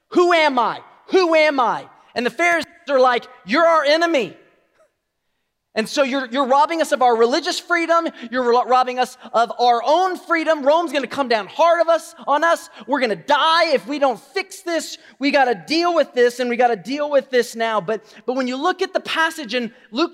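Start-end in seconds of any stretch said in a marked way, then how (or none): 0.1-1.9 loud, high demand; emphatic preacher voicing a challenge; forceful acted challenge. Voices Jesus forcing the question. 3.4-17.8 loud, urgent acted outrage; acted angry accusation.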